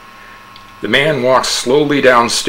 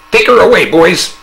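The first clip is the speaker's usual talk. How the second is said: much higher, louder order; commanding order; 9 semitones higher, 7 dB louder than usual. A narrator's reading. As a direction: call the men to act. Psychiatrist orders police.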